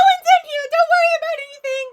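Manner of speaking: very high, loud squeal; panicked Gizmo. Acted. Gizmo tries to cover for the hidden player, panicked squeal. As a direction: panic in a piercing high-pitched little voice. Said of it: "I can't do a panic Gizmo".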